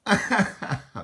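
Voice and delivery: laughing. Real, not acted. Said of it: "that's not a good impression of him".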